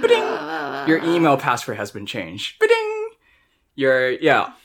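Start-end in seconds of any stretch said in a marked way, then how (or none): 0.0-0.9 high sing-out email ding; notification chime imitation. Friend mimics his email notifications arriving, high chime imitation. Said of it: "email notifications". 2.6-3.0 high sing-out email ding; notification chime imitation. Friend mimics his email notifications arriving, high chime imitation. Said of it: "email notifications".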